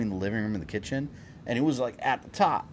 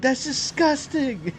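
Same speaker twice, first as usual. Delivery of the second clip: high, loud, drawn-out disgusted shout; disgusted yell; 3 dB louder, slower than usual. Retold or imitated. Owner wrestling the dog's snout open, shouting in disgust. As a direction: stretch it out in revulsion at the dog. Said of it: "start yelling at him".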